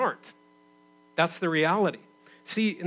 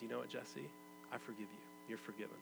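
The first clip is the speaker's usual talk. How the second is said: very soft, lower voice of a forgiving wife; tender forgiveness; 4 semitones lower, 20 dB quieter, faster than usual. Retold or imitated. Wife forgiving her cheating husband, tender and hushed. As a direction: imitate a wife gently letting it go, hushed.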